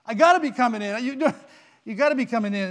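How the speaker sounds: loud, pleading insistence; desperate protest. Acted. Man refused entry begs Saint Peter, loud and desperate. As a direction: shout it like a man refused at the gate.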